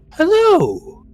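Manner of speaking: high, loud sing-song hello; feminine queen voice. Acted. Fish queen greeting the player with a high hello. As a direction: greet him like a coy monster queen. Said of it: "a very feminine, uh, voice go".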